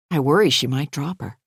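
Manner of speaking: ordinary delivery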